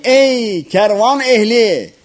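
high, slow herald's hail; calling out loudly. A narrator's reading. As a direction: hail a caravan from a distance. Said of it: "Yüksek sesle onlara".

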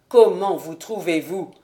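lightly voiced older father, concerned; worried father. A narrator's reading. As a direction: call out to his daughter with relief. Sir William voiced in audiobook.

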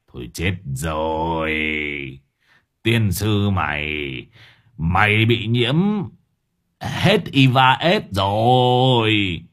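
slow, drawn-out lament; grave dismay. A narrator's reading. Old gangster warning his underlings of infection, dismayed. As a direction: stretch each phrase out in doom.

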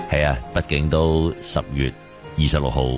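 ordinary talk

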